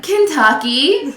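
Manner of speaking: high, slow, questioning reply; tentative answer. Retold or imitated. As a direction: draw it out as a retold unsure answer. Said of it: "And I was like".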